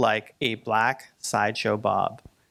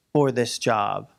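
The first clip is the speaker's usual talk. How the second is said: ordinary talk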